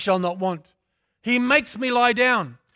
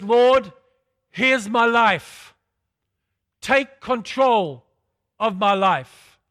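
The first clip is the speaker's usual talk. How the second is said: slow, loud surrender to God; surrendering prayer, drawn out; earnest prayer; 5 dB louder, much slower than usual. Acted. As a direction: plead it out slowly and loudly.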